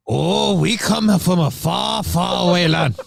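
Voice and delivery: high, slow put-on accent; April impersonation. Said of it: "my best April impersonation".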